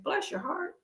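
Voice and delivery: ordinary talk, nothing marked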